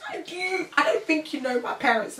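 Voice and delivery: high-pitched, insistent objection; acted alarmed pushback; mock protest. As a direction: plead that they don't understand.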